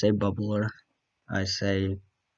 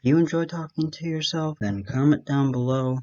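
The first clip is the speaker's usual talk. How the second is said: higher-pitched, emphatic call to viewers; owning it proudly; 4 semitones higher than usual. Real, not acted. Vlogger asking fellow self-talkers to comment, proudly emphatic. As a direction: announce it boldly to the audience. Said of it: "I'm gonna own it".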